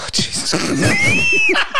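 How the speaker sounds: high, squealing laugh of disbelief; shocked laughing cry. Real, not acted. Laughing in shock at the burn idea. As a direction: shriek it through laughter.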